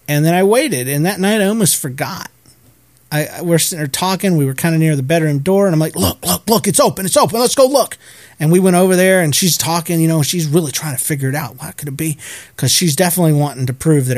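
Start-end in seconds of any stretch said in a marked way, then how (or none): 5.9-8.0 eager, rising urgency; excited urging. Retold or imitated. Showing her the open curtains, excited. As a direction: hurry someone over to see, higher voice.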